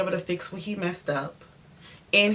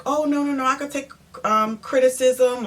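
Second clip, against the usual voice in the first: higher-pitched, hasty reassurance; placating painter; 7 semitones higher than usual. Retold or imitated. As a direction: imitate the contractor eagerly placating. Acts out painter's eager excuse.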